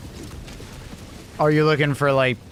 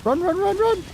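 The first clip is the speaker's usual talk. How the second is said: being chased. Real, not acted. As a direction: run run run squealed very high.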